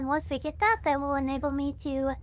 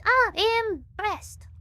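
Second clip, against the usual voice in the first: preacher; 6 semitones higher, much slower than usual. Shown as a demonstration. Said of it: "preacher voice".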